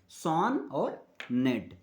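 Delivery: "सॉन और नेट" slowly, each syllable apart; syllable split. Shown as a demonstration. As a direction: stretch सॉन and नेट as two separate beats. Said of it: "इसके पास दो syllable है".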